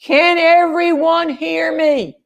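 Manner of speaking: high, slow mock yell; imitated scream. Husband hollering down to the canoe from the road, imitated scream. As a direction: holler it slowly, as if from far above. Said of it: "screamed at the top of his lungs".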